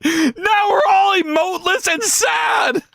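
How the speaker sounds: high, drawn-out mock crying; hammed-up wail. Real, not acted. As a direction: wail it slowly like an overdone sob. Comic lament over lost emotes.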